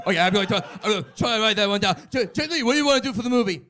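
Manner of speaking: high, loud mock Stallone; a hammed-up Stallone shouting while jotting notes. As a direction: bark it out like a flustered director.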